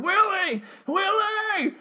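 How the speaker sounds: very high, loud mock angry yell; weird pissed-off voice. Calling out to stoned buddy Willie in a mock angry voice. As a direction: shout his name like a furious crank, for fun. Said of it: "I was doing this weird, like, pissed off voice, but for fun".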